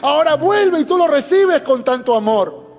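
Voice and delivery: put-on resentful older brother. Preacher voices resentful older brother.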